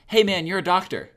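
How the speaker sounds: just bellow it at him; yelled. Shown as a demonstration. Yelling at a passing doctor, loud flat shout. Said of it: "if you just yelled".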